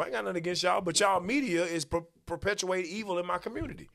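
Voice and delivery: ordinary talk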